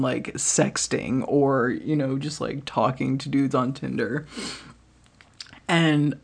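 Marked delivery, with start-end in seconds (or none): none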